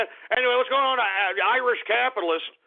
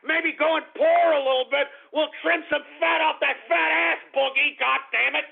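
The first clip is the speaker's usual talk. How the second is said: higher, heated ranting at him; angry rant; 8 semitones higher than usual. Real, not acted. Host ranting at Boogie, angry raised voice. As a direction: tear into him, fed up.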